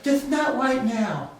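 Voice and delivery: put-on a toddler stating it plainly; imitating his little son. Dad voicing his son's blunt answer, childlike and slow. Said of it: "And he goes".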